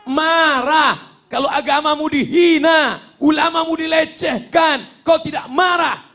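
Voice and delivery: fiery exhortation. Real, not acted. Preacher challenging those whose religion is insulted, fiery shout. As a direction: cry it out in rising indignation.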